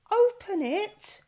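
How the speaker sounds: high, loud excited call; eager urging. A narrator's reading. Animals urging George to open the present, excited. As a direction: push him to open it now. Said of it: "they said".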